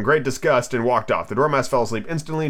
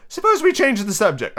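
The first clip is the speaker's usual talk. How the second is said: high, light suggestion; timid girl changing topic; Alice character voice; 9 semitones higher than usual. Acted. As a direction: propose it in a high girlish voice. First take, given to Alice.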